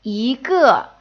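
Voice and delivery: "Yi" rising, "ge" falling sharply; second tone yi. Shown as a demonstration. Teacher reads the changed column, "Yi" rising. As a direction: lift "Yi", then drop "ge". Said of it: "read the second column one more time".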